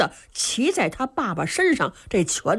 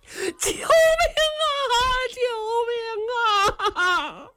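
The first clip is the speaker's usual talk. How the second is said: cry for help; 13 semitones higher than usual. A narrator's reading. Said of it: "正是这老头的声音".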